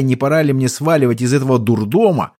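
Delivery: ordinary delivery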